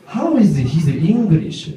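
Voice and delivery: put-on slow Japanese-accented question; careful learner's English in a Japanese accent; Japanese student accent. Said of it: "a strong Japanese accent".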